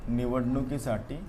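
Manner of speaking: ordinary talk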